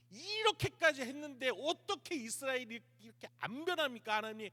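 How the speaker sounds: high-pitched, bursting frustration; exasperated complaint. Acted. Elijah, about to burst, asks God why, exasperated plea. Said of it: "엘리야가 속이 터지는 거예요".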